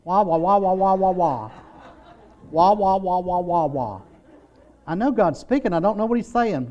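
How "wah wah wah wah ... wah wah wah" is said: muted trombone wah-wah. Pretend. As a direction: mimic a muffled adult droning wah wah. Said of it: "Just like Charlie Brown's teacher".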